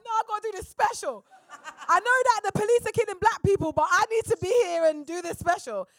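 high, loud retold protest; acted-out stubborn pleading; insistent refusal. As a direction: argue back as if the parents won't listen. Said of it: "And I'm like".

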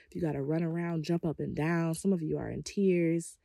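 normal talking voice

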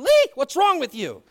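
high, loud mock exasperation; hammed-up wife protesting the light; wife yelling at him for the flashlight; wife's exasperated cry. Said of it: "she's going".

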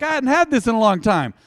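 mock frustrated exclaim. Preacher mimics a sleep-deprived listener, loud mock complaint. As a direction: blurt it like a tired churchgoer. Congregant voicing groggy frustration.

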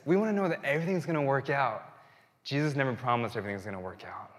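normal talking voice

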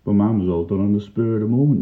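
ordinary delivery, nothing marked

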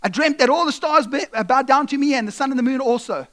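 high, loud, bursting with news; a boy blurting his dream, eager; excited kid. Retold or imitated. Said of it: "he's like".

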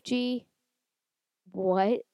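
normal talking voice

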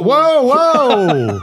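startled whoa. Real, not acted. Co-host jumps up from seat.

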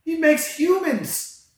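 slow, soaring exclamation of wonder; fired up and slow. Real, not acted. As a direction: preach it slowly with rising fire. Excited preaching about God.

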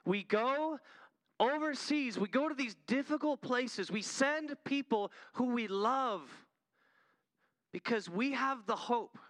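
fervent preaching, climbing in pitch; preaching climax. Acted. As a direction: build to a stirring altar-call peak. Sermon climax building.

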